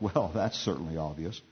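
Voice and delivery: low, understated scoff. A narrator's reading. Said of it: "Bukiah scoffed".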